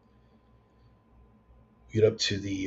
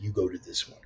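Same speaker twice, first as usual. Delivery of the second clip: softer, certain instruction; firm Father David; 2 semitones lower, 9 dB quieter than usual. Retold or imitated. Father David directing them to the park, soft and certain. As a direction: say it softly but sure. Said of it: "but he said".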